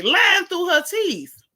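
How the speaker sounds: slow, high, shouted accusation; loud emphatic. Real, not acted. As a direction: shout it out slowly.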